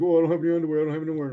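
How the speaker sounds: high-pitched mock protest of the other guy; high, helpless mimicry of an official; imitating flustered staffer. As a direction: put on a high voice, pleading innocence. Voices flustered staffer refusing him.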